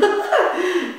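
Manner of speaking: high giggling calf laugh; calf's laugh. Acted. Calf laughing at its mother, high giggle. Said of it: "Het kalf begint te lachen".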